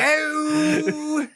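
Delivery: high, slow, hammed-up mock British "Oh, oh, behave."; fake British accent. Friends at a strip club fake a British accent for laughs. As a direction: ham up a fake Brit to the strip club girls. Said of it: "sitting there like ... we were fake as fuck".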